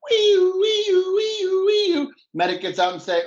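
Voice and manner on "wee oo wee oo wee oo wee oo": high wailing siren noise; siren imitation. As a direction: wail like an ambulance siren. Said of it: "Here comes the meat wagon".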